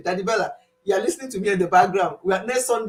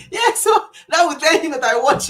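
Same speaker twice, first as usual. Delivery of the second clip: laughing through the words; 8 semitones higher, 8 dB louder than usual. Real, not acted. Vlogger tells viewers while laughing hard. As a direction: she can't stop giggling at her own story. Laughing at her own gossip.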